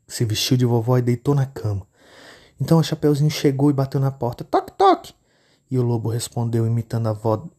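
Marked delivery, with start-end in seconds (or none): 4.4-5.1 put-on much higher, childlike knock knock; the girl announcing herself sweetly; little girl knocking. Menina bate na porta. (menininha batendo, voz aguda)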